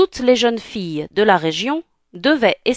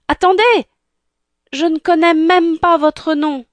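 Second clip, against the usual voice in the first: high, loud plea to stop; desperate calling out; 9 semitones higher, 5 dB louder than usual. Acted. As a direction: call after her urgently. Prince calls after fleeing Cinderella. (appel pressant, voix forte et aiguë)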